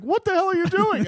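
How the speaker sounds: high, loud panicked yell; alarmed yell. Retold or imitated. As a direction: shout it as the lights go dark. Pilot yelling at Davidson in crash.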